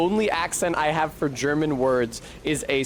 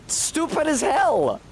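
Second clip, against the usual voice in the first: real exasperated outburst; 12 semitones higher than usual. Real, not acted. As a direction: burst out in real indignation. Ranting about Low German.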